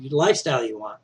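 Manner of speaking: normal talking voice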